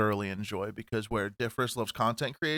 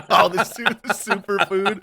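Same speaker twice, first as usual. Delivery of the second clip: mocking; 8 semitones higher, 8 dB louder, slower than usual. Mocking the superfood claim.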